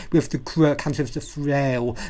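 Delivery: plain reading voice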